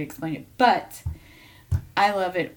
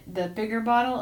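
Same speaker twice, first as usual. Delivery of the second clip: normal talking voice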